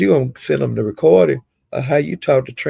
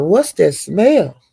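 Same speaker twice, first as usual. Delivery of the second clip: drawn-out, high-pitched retold question; slow, higher mock disbelief; mock disgusted question; 5 semitones higher, much slower than usual. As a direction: stretch it out slowly, imitating someone reacting to a stink. Retold reaction to bad breath.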